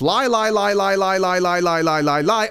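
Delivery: rapid singsong string on one sliding note; mocking repetition. Host mocking Liberals' endless lying, droning repetition. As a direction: rattle it off as an endless run.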